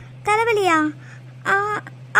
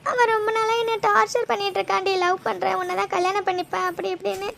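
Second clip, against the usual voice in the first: tearful confession with sobs between phrases; crying. Acted. Telling a friend about being harassed, crying and scared. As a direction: confide it through your tears. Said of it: "முதல்ல அழுகுறது நிறுத்துடி".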